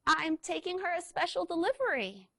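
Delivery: high, eager little scooter; squeaky voice. Acted. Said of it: "squeaked Little Red".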